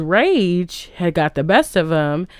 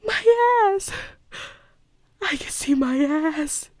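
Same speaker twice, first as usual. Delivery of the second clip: high, wailing movie-line imitation; tiny, tearful melodramatic squeak; Meryl Streep; 10 semitones higher, 5 dB quieter, slower than usual. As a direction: whimper it high and softly in mock horror. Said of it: "the perfect, you know, Meryl Streep way".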